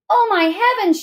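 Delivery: high, mildly dramatic exclamation; high-pitched storybook alarm; alarmed cry. A narrator's reading. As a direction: read it as a mother's startled cry. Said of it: "she cried".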